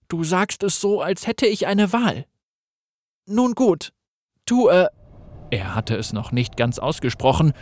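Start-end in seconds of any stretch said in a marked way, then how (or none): 0.1-4.9 put-on high, pleading young man's voice; plead, crippled and hopeless; desperate young Gareth. Crippled Gareth asks the voice how it can help, desperate.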